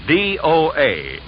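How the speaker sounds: normal talking voice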